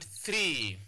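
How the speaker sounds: "three" with a drawn-out ee; long vowel. Shown as a demonstration. Teacher giving a one-syllable example, long vowel. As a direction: hold the ee in "three". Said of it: "This one is what we call a long vowel".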